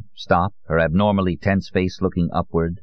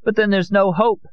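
very high, anguished wail of words; high-pitched hopeless outburst; despairing cry; 3 dB louder than usual. Acted. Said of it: "Amparo cried".

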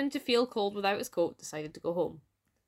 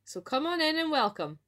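higher-pitched storybook welcome; welcoming cry; 8 semitones higher than usual. A narrator's reading. Smith inviting the little cake in, welcoming cry. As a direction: call out an eager welcome. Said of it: "he cried".

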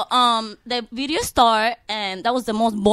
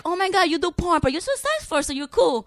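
fake admirers; 7 semitones higher than usual. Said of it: "You know, like".

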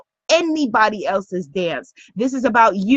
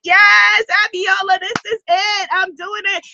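mock eager student; 7 semitones higher than usual. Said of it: "We're like".

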